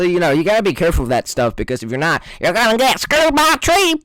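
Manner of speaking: normal talking voice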